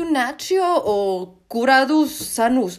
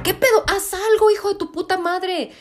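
angry outburst; 8 semitones higher than usual. Retold or imitated. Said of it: "Yo dije".

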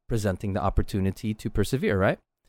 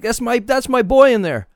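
much higher and louder, proud rush; excited proud father, much higher and louder; overly excited; 12 semitones higher, 7 dB louder than usual. Retold or imitated. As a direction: burst out like a dad cheering his boy. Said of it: "I got, like, overly excited".

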